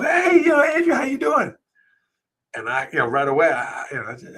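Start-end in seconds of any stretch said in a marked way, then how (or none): 0.0-1.5 much higher, loud bright hello; cheery greeting. Retold or imitated. Principal greeting Andrew warmly on arrival, much higher and cheerful. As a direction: call out a big cheerful hi. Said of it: "in her cheery self".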